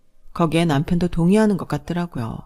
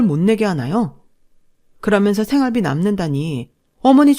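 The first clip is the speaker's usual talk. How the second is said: pointed, raised protest; indignant daughter-in-law; 3 semitones higher, 4 dB louder than usual. Acted. Daughter-in-law protesting to her mother-in-law over money, indignant. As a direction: argue back politely but firmly.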